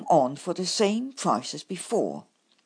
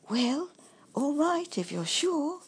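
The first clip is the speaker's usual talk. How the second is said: kindly badger; 9 semitones higher than usual. A narrator's reading. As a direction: gently coax a friend to rest. Bumble gives in.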